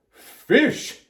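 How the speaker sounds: high, loud outburst; Mister Rogers. A narrator's reading. Mister Rogers hears about the fish, exclaiming loudly. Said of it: "he said".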